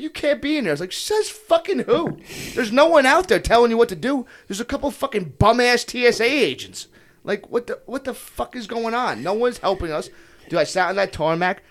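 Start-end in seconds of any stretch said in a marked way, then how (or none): 0.0-0.7 high-pitched imitated scolding; mock objection. Police telling traveler to get out of terminal, high mock objection. 0.9-10.1 high, indignant retold rant; defiant retort. Traveler arguing with police over his luggage, defiant indignation.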